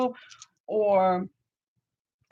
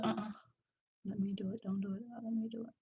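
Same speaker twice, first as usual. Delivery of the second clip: quiet aside; 14 dB quieter, much faster than usual. Real, not acted. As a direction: murmur it off to the side. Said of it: "aside to child".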